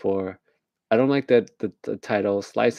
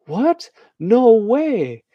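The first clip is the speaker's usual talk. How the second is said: very high, loud disbelief; mock shock; 13 semitones higher, 7 dB louder, much slower than usual. Extra reacting to cheating news, mock shock. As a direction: squeal it in hammed-up amazement.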